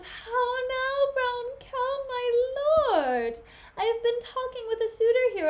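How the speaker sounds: high, sing-song greeting to a lord; hammed-up Desdemona. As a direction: sail in high and theatrical. Plays Desdemona greeting her lord.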